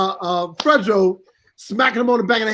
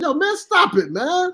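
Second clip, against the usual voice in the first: higher, strained plea to stop; high pleading; 7 semitones higher, slower than usual. Retold or imitated. Retelling the co-star's taunts, pleading higher. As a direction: beg him to quit.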